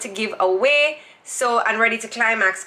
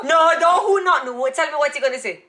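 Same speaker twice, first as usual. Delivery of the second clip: ordinary talk, nothing marked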